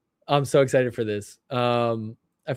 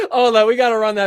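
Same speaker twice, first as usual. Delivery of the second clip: louder, laughing disbelief; 7 semitones higher, 7 dB louder than usual. Real, not acted. Streamer asks chat to replay the clip, laughing. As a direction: crack up and ask for a replay.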